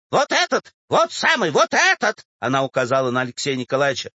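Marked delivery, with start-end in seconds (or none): 0.1-2.2 high, agitated accusation; accusing old woman. Acted. Old woman points out the clerk, accusing. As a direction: point out the culprit in a high squeal.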